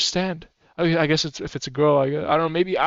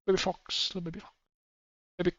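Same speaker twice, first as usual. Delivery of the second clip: soft coo; 10 dB quieter, faster than usual. Real, not acted. Cooing at baby fox.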